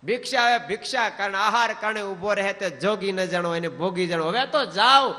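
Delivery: high, loud defiant reply; loud retort. Retold or imitated. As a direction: fling the verse back. Renouncer's parting retort.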